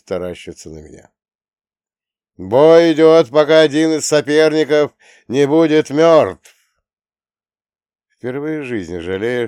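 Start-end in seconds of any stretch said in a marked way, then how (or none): 2.4-6.5 arena announcer. Acted. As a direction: present the fighter to a roaring crowd. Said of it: "надрывающегося ринг-анонсера".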